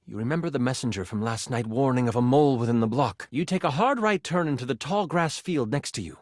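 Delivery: usual speaking voice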